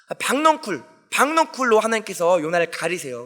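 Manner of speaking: usual speaking voice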